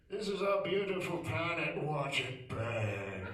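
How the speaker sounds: slow, hushed nature documentary voice; Attenborough narration. Retold or imitated. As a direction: narrate like a wildlife film. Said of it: "his documentaries".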